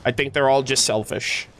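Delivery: usual speaking voice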